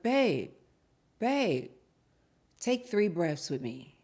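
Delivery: soft, low, slow coaching; hushed breathing-exercise voice; calming friend. Acted. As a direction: talk them down gently, leaving pauses. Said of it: "I would be like".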